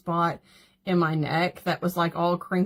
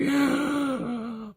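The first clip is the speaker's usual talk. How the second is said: shocked gasp; 5 semitones higher than usual. Retold or imitated. Retelling the wrinkled-neck photo, she gasps in mock horror. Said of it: "and I'm like".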